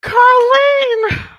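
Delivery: high, loud cry of name; high screaming laugh; laughing shriek. Real, not acted. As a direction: shriek it out in hysterics.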